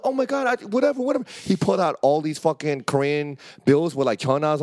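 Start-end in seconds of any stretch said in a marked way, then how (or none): 0.0-1.2 pitched-up panicked whatever; high flustered surrender. Retold or imitated. Grabbed friend pleading, high flustered. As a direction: give up anything to escape. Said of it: "He could pull out his wallet".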